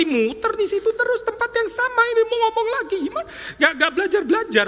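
squeaky high mock voice, fast, laughing; a comic squeal of frustration; mock-frustrated falsetto at the stubborn crowd; exasperated Jesus. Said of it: "Emosinya Yesus".

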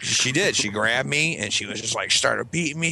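ordinary talk

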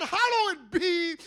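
drawn-out high proclaiming; proclaimed prayer. Acted. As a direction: lift it up like a sung proclamation. Repeats prayer line proclaimed.

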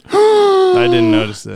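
very high gasping surprise; mock surprised gasp. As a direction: gasp like a startled puppet.

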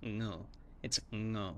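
ngu starting with ng; Thai ngu. Shown as a demonstration. Teaching the Thai word for snake. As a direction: begin ngu on an ng sound. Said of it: "Which is not a snake".

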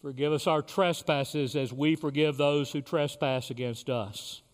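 leading the Lord's Prayer with long pauses; short phrases with pauses. Real, not acted. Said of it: "I will say a phrase".

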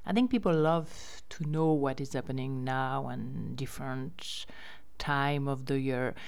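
ordinary talk, nothing marked

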